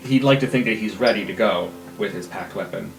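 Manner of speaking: ordinary delivery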